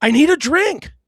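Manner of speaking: high, loud demand for a drink; injured husband snapping at his wife; demanding snap. Retold or imitated. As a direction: bark it out impatiently. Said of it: "I'm like".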